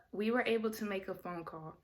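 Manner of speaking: normal talking voice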